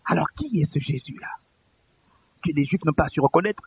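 low, near-whispered rhetorical question; hushed question. Real, not acted. Preacher asking who Jesus is, hushed. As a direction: ask it very softly, almost whispering.

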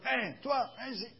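high-pitched commanding call; sudden bossy summons; intimidating summons. Acted. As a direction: order someone over with sheer tone. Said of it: "Comprenez que rien qu'avec le ton".

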